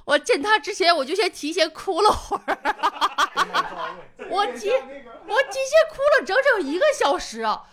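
边笑边说，笑声不断; 说到自己提前哭，忍不住笑出来; laughing while telling. Real, not acted. Laughing at her own crying story. (笑着讲述)

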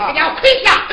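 loud, stern order; mother orders her son to kneel; stern command. Acted. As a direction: make him kneel with your voice.

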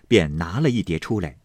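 plain reading voice

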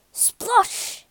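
high, splashy exclamation; splash sound effect. A narrator's reading. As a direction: make the word burst like water. Narrator acts out the splash.

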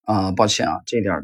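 usual speaking voice

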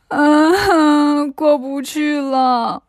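slow, whimpering monk in despair; crying. Acted. Monk weeps he can't cross the river, slow sobbing. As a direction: sob out that there's no way across. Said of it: "唐僧就哭了".

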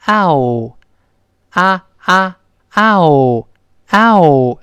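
嗷 drawn out loud and slow from 啊 to 哦; 啊啊嗷 stretched and sliding open to round; 嗷, a loud slow gliding vowel demo; diphthong demo. Shown as a demonstration. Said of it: "是这么一个声音啊".

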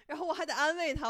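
ordinary talk, nothing marked

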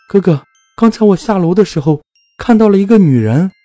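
frightened little sister, pitched very high; high-pitched, scared plea to a big brother; trembling with fear. A narrator's reading. Sister telling her brother about a ghost she saw, trembling and high-pitched. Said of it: "她颤抖地跟我说".

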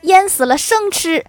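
high, loud burst of frustration; exasperated. Retold or imitated. Husband gives up on cooking the fish, exasperated. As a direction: blurt it out at the end of your patience. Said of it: "气急败坏地说".

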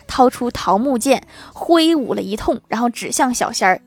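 ordinary delivery

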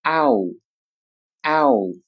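slow, drawn-out ow gliding from a to u; teacher modelling ow; diphthong ow. Shown as a demonstration. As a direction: glide the ow slowly from a to u. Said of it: "发作ow，ow".

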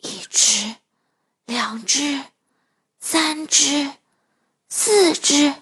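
soft, hushed counting; whispered counting. Acted. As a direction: count quietly so the piglets sleep. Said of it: "小声地数了起来".

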